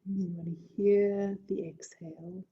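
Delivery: airy whisper. Acted. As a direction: breathe the words out softly. Soft breath cue in meditation.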